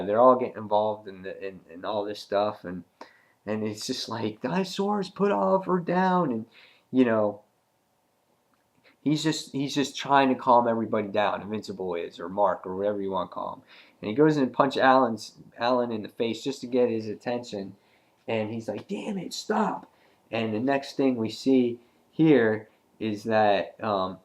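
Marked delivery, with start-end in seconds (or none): 4.4-6.3 high-pitched imitation of a pleading order; mock call across a fight; retold urgent demand, pitched way up; urgent command. Mark pleads during the fight. 18.9-19.9 retold exasperation, pitched way up; exasperated command. Punches Alan to get attention.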